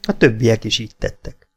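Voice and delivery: usual speaking voice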